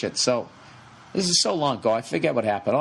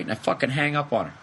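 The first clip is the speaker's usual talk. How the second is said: ordinary talk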